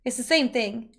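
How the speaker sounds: ordinary talk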